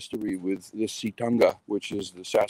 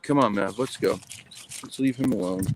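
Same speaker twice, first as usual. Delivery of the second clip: ordinary delivery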